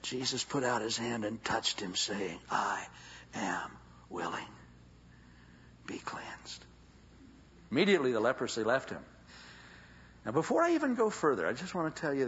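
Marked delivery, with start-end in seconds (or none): 2.5-6.6 soft, low, whispered command of healing; tender whisper. Acted. Jesus quietly healing the leper with a whisper. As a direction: breathe the words with compassion.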